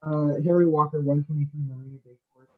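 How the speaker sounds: muffled, as if through a hand. Real, not acted. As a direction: speak muffled behind a hand. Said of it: "you're kind of muffled now".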